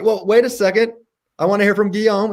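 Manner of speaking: high-pitched mock objection; mock protest. Host interrupting the mad scientist's answer, mock protesting.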